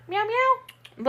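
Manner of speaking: high, soft little cat meows; mock meow. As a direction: meow softly at the cat like a kitten. Cat imitation to pet.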